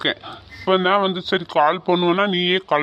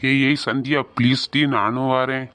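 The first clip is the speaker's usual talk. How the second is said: put-on lower, fond boyfriend pleading on the phone; 7 semitones lower than usual. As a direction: drop the voice low and sweet, like a boy missing her. Boyfriend begging to come.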